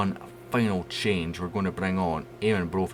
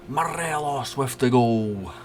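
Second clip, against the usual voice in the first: held-back excitement; 6 semitones higher, slower than usual. Real, not acted. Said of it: "I'm trying not to wake the kids up again".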